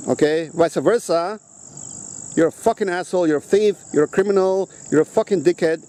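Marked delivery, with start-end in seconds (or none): none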